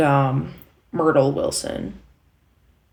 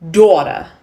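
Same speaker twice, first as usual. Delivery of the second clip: "Daughter" in a loud New York accent; New York pronunciation demo; 2 semitones higher, 8 dB louder than usual. Shown as a demonstration. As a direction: hit "Daughter" New York style, dropping the r. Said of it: "the way they said the word daughter".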